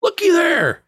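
put-on high, loud, drawn-out cry of wonder from a dazzled old-timer; amazed customer. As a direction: shout it like a man seeing magic for the first time. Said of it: "he goes".

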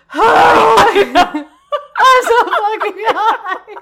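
high, slow, laughing drawl; slowed-down giggling complaint about being too high; laughing stoned groan. Retold or imitated. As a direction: moan it through giggles as if totally wasted. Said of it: "And I'm just like".

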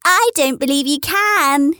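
high, doubtful challenge; doubting wild rabbit. A narrator's reading. Wild rabbit challenges the toy rabbit, high and doubtful. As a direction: dare the other one to prove it. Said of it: "he said".

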